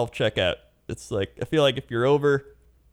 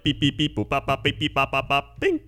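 beeping sound; 4 semitones higher than usual. Retold or imitated. Imitating scanner.

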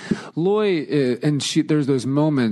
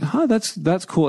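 put-on casual kid voice; 9 semitones higher than usual. Imitating daughter's shrug.

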